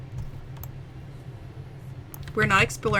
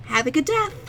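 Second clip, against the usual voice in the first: higher bright clerk slip; customer service voice; 5 semitones higher than usual. Retold or imitated. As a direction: cheerfully blurt the wrong word. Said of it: "I'd just be like".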